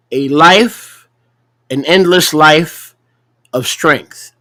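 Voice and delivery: ordinary talk, nothing marked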